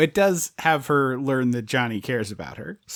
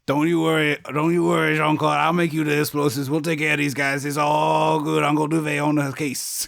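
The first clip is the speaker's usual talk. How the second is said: thick mock Cajun accent; Wilford Brimley as Uncle Douvee; 2 semitones higher than usual. Host doing his pet movie impression, hammed-up Cajun accent. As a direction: play a Cajun uncle, steady and drawn-together. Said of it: "Wilford Brimley as Uncle Duvet".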